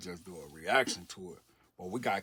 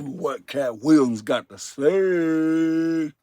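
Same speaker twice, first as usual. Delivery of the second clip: louder, slow hyped announcer build-up; hype intro; 5 semitones higher, 13 dB louder, much slower than usual. Real, not acted. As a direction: sell the clip like a showman. Hyping up the reaction clip.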